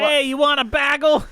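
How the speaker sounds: high, loud hammed-up New Jersey accent; playful New Jersey shout; mock New Jersey yell. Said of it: "New Jersey people are just screaming".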